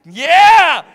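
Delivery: high shouted cheer; huge, explosive whoop of approval; triumphant cheer. Real, not acted. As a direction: shout it out in celebration. Coach cheering on a participant.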